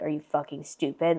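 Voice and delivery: a hint of a nasty, sneering friend; mean friend. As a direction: toss off a mock of her insult. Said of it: "freak out on me and be like".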